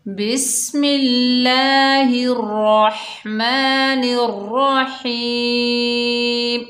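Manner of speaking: solemn slow Quran chant; Quranic recitation. Shown as a demonstration. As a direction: recite it slowly in a drawn-out chant. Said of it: "ताऊज़ और तस्मीह की तिलावत से करते हैं".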